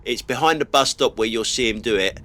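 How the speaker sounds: ordinary talk, nothing marked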